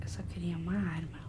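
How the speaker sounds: soft unvoiced whisper. Real, not acted. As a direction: whisper so the monster can't hear. Whispering in hiding.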